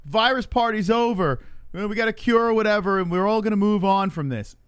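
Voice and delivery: announcing good news. Acted. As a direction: call it out high and loud like an all-clear. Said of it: "when they say".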